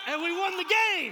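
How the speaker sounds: high, very loud victory cry; triumphant shout. Real, not acted. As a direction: yell the big win to the whole crowd. Triumphant climax of the story.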